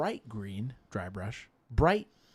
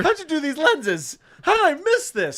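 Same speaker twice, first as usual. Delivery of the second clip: excited; 9 dB louder than usual. Real, not acted. Amazed at lenses.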